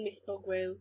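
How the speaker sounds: normal talking voice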